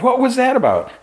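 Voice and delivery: high-pitched bewildered question; incredulous squeal of a question; baffled reaction. Acted. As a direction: react in mock disbelief, voice shooting way up. Storyteller's own baffled reaction to the plot.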